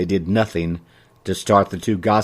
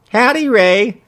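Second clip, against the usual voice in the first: high, louder reply held almost on one note; near monotone; 13 semitones higher, 5 dB louder, slower than usual. A narrator's reading. Customer returns the shopkeeper's greeting, almost monotone. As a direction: keep it high and nearly level. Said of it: "she replied in an almost monotone manner".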